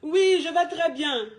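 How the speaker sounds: bright, breezy "Oui, je vais très bien", drawn out slowly; a woman brushing off her ex's call cheerfully; cheerful phone answer. Shown as a demonstration. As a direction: sound carefree and happy on the phone. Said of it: "Toi aussi, tu fais un genre".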